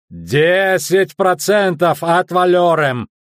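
high, drawn-out indignant shout; shouting merchant. A narrator's reading. Merchant haggling over customs duty, shouting. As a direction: yell it out slowly across the deck. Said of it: "раскричался с середины палубы".

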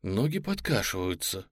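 plain reading voice

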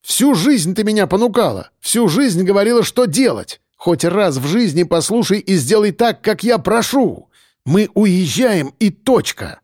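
heated tirade without extra volume; losing patience, shouting. A narrator's reading. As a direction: lay down the law to your mother, pitch up. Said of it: "не выдержав, Марк крикнул".

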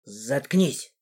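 low, restrained order; commanding. A narrator's reading. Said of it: "приказал он принцессе".

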